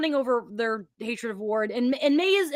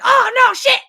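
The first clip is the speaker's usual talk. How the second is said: higher, louder mock angry outburst; mad outburst; 11 semitones higher, 8 dB louder than usual. Cohost imitating Tony's mad outburst at the screen, loud. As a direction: blurt it out in frustration. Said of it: "Tony got mad. He was like".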